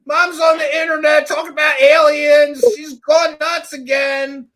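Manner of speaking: high, loud, drawn-out mocking shout; mock kid taunt. Host voices her kids complaining about Mom online, high mocking shout. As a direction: holler it slowly like an embarrassed kid.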